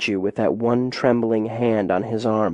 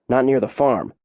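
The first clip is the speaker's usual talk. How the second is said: ordinary delivery, nothing marked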